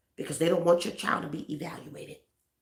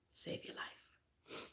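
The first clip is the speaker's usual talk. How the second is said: slow, much softer whisper; 21 dB quieter, much slower than usual. Acted. Pastor warning parents, slow grave whisper. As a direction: hush it slowly for gravity.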